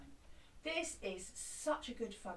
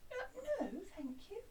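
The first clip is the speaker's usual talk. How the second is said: polite refusal; 8 semitones higher, 4 dB quieter than usual. Acted. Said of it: "the cobbler needs to be".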